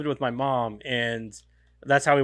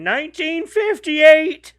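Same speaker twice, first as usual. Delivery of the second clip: put-on high, slow teasing old-timer line; mock old-timer; 13 semitones higher, 8 dB louder, much slower than usual. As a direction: drawl it like ancient history. Joking about being old.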